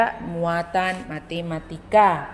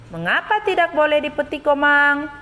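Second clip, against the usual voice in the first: "Mengapa tidak boleh dipetik, Komang?" much higher and fast, rising to "Komang"; questioning intonation; 8 semitones higher, 4 dB louder than usual. Shown as a demonstration. Teacher demonstrates question intonation to pupils, higher and fast. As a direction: ask it brightly with a lifted question tune. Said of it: "Ibu Guru bacakan ulang ya kalimat tanya".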